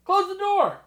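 calling out. Acted. Calling to partner offstage.

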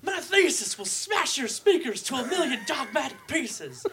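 high, loud, slow announcer impression; Harry Carey voice. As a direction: boom it like an old ballpark announcer. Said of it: "Harry Carey voice?".

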